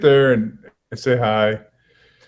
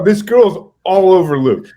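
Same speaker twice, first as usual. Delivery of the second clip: louder, slower, pressing it; raised emphatic telling; 5 semitones higher, 5 dB louder, slower than usual. Real, not acted. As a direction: land the outrage of it. Stressing the outrageous detail.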